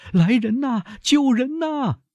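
very high, drawn-out screaming plea; strained, panicked high wail; choked cry for help. A narrator's reading. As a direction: cry out desperately for anyone to come. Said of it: "用憋住的声音喊道".

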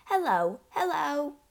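high parrot hellos; parrot calling. A narrator's reading. Bird calls down the chimney to her, high parrot calling. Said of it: "the bird called down to her".

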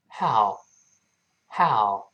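how drawn out slowly from a voiceless h; how with breathy H. Shown as a demonstration. Teacher shows learners the right how, slow and drawn out. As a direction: stretch "how", starting on a voiceless h. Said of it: "不要读呵，所以读how".

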